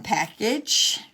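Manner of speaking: normal talking voice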